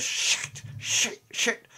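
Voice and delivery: whispered swearing in character; hushed swearing. Acted. Wounded driver swearing as she bleeds, whispered. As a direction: swear under the breath, mostly voiceless. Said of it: "says a, a, quite a few swear words".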